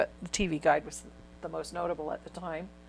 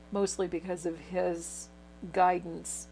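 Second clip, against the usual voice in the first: ordinary delivery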